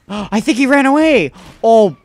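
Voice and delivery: gasp, then relieved high cry; startled relief; gasping surprise. Real, not acted. Gasps, pursuer seems gone.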